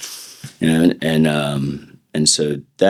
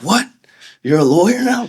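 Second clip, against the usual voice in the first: high, loud incredulous question; old buddies hearing he's a lawyer, in disbelief; disbelieving; 4 dB louder than usual. Retold or imitated. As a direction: act stunned he's a lawyer now. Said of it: "They're like".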